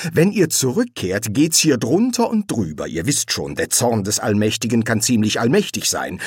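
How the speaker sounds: high, animated, rambling self-justification; flustered kindly angel, justifying himself. A narrator's reading. Angel explaining why he gave away the sword, flustered. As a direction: plead your case in a rising, agitated flow.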